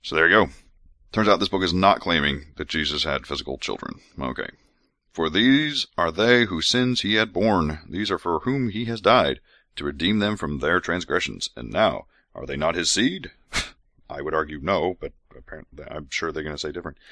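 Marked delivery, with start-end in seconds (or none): 5.1-13.3 slow, solemn reading of scripture; measured scripture recital. Acted. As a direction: read it like holy writ. Reads scripture aloud.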